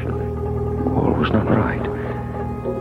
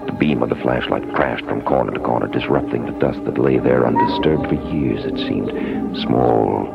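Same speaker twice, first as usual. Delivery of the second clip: eerie story narration; 4 dB quieter than usual. A narrator's reading. Narrator describes dark house.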